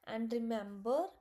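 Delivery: ordinary delivery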